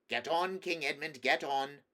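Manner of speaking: calling from behind. A narrator's reading. Trumpkin urges Edmund on from behind and above, calling out. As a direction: urge him onward from up the path. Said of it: "came Trumpkin's voice from behind and above".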